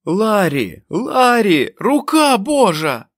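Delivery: very high, loud, slow ecstatic shout; a man screaming in rapture at a miracle; wild yell. A narrator's reading. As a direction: bellow in wild joy at the sky. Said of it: "Ральф зарепетував".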